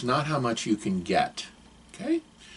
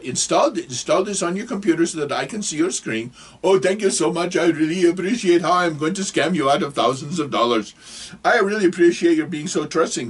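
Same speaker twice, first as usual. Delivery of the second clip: higher mock Indian scammer accent; bad Indian accent; 6 semitones higher than usual. Scammer thanks trusting victim being scammed, bad Indian accent. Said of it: "I'm sorry for the bad accent".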